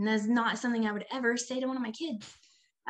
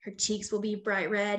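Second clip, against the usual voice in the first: ordinary talk, nothing marked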